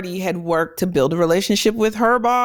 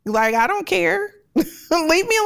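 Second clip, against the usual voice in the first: dismissive; 9 semitones higher than usual. Retold or imitated. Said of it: "So it's just like, like".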